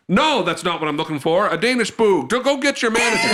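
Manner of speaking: mock outrage building to a high demand; stubborn demanding. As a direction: refuse to back down, pitch rising. Stubborn father demands the manager.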